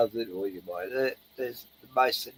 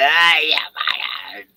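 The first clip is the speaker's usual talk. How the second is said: shout at birds; 3 semitones higher, 4 dB louder than usual. Retold or imitated. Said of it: "all you do is say".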